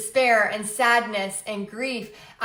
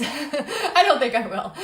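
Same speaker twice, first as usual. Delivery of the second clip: laughs through the words, a bit slower; laughing; 4 semitones higher, slower than usual. Real, not acted. Laughing at herself while repeating her vow to viewers. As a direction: chuckle the repeat, half-amused at herself.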